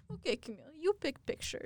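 softly imitated Russian coach; high, soft mock Russian accent. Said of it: "imitate his accent all the time".

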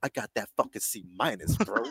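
lightly put-on bragging, drawing out the C minus; mock proud boast. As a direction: play at bragging to your bros. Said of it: "I'm not proud".

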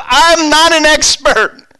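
high, loud and slow; preacher's loud, high protest; emphatic denial. Real, not acted. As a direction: insist it with full force. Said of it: "I go".

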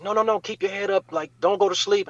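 high, urgent pleading as the frantic mom. Retold or imitated. Mom begging her son not to fall asleep, frantic. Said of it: "my mom is frantic".

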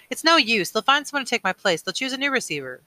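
high, plaintive objection; despairing protest. A narrator's reading. Boy rejecting the elder's plan, despairing high protest. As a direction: protest hopelessly, starting loud and easing off.

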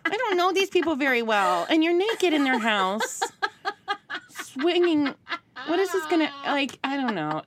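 high, anxious, rushed inner panic; panicked mom. Retold or imitated. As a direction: fret like a mortified mother. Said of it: "instead it's like".

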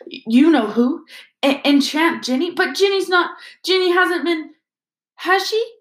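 rising panic, can't finish the thought; stunned disbelief. Acted. Father realising his daughter was enchanted, stunned. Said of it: "in a stunned voice".

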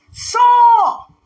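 loud high call of the name; shout across valley. Retold or imitated. David calling to Saul across the valley, shouting. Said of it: "and he shouts".

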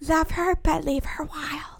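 slow breathy whisper; whispered indie voice. Shown as a demonstration. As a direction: hush it like a dreamy quote. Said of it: "Say that".

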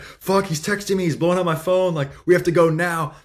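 high-pitched, retold urgency; urgent fretting. Trying to do his tie as the phone blows up, urgent fretting. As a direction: act out being rushed out the door. Retelling own rush to leave.

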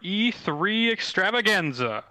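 booming ringmaster announcement, slow. Acted. Podcast intro announcer.

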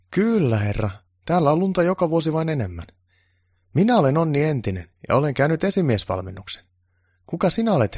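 an eager, proud little helper; keen underling voice. Acted.

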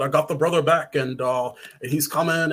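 ordinary talk, nothing marked

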